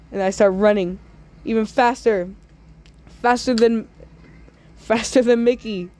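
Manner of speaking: normal talking voice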